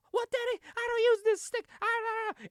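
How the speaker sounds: high-pitched squeaky imitation of a caught cheat; mock flustered protest. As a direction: babble excuses in a comic high voice. Acts out manager protesting to referee.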